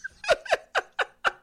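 laugh. Real, not acted. Host laughs on air at caller.

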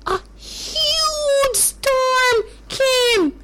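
slow, high, dramatic telling; dramatic storytelling. A narrator's reading. Telling children about the storm, slow and dramatic.

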